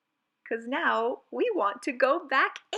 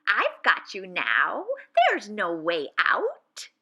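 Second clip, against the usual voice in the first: witch's shout; 6 dB louder than usual. Acted. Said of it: "and with a shout".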